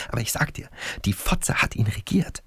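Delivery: whispering the dark truth to his friend; whispered secret. Acted. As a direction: breathe the verdict like a secret. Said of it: "Leise, als wäre es ein Geheimnis".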